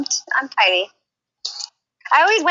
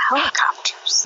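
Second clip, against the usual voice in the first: whisper; 4 dB quieter, slower than usual. Real, not acted. Echoing a Goodfellas line.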